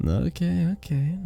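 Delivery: high, placating surrender; playful relenting. Acted. Bartender promises to stop teasing the guest, playful relenting.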